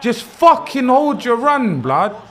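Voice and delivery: loud, slow, high frustrated yell; angry shout. Real, not acted. Gamer yelling at his striker, angry shout. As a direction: bark it at the screen like the run cost you. Yelling at game offside run.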